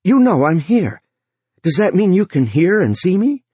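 called out loud and high. Acted. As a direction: shout it at the screen. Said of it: "Joe said loudly".

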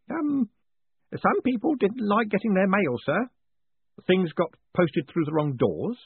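put-on high, fussy old postman hinting darkly; old man warning his boss of trouble; meaningful old Grote. A narrator's reading. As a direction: lean on the hint like a worried clerk. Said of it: "the old man added meaningfully".

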